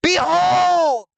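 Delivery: very high, loud mystic summoning; shouting fortune teller. Pretend. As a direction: bellow the summoning like a seer. Seer summons vision, shouting.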